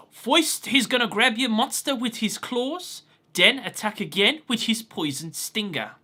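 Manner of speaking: hint of a Brooklyn accent, casual. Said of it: "translate that into Joey's way of speaking with a Brooklyn accent".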